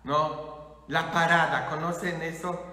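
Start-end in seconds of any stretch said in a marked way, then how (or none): none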